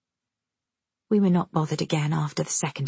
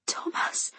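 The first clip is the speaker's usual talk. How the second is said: terrified, soundless gasp of a name; frightened whispered cry; voiceless whisper; 8 dB quieter than usual. A narrator's reading. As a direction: whisper it with no voice at all. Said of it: "I had no voice at all".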